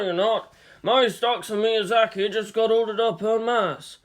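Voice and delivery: a hint of an old shopkeeper; lightly voiced chatty store owner. Owner chats with customer.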